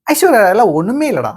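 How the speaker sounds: high, loud, admiring boast; proud exclamation. Acted. Grandson praises grandmother's beauty over Aishwarya, proud exclamation. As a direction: brag as if nobody could compete.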